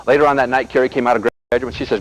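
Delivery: usual speaking voice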